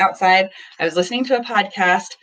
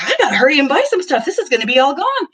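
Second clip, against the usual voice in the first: mock eager shopper; 8 semitones higher, 3 dB louder, faster than usual. Mom eager to buy from store.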